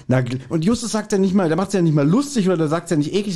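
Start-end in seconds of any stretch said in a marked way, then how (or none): none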